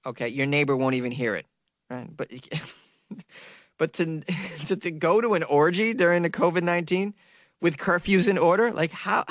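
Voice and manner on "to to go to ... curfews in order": usual speaking voice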